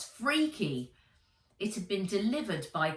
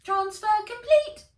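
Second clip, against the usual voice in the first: much higher, louder robotic announcement; robot butler voice; 11 dB louder than usual. A narrator's reading. Android butler reporting the file transfer, high robotic voice. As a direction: chime it like a machine confirming.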